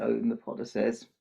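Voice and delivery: ordinary talk, nothing marked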